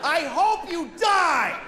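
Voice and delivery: high, slow, screamed curse; furious road-rage shout. Retold or imitated. Screaming at a driver who cut in, furious shout. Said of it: "I said that to a person".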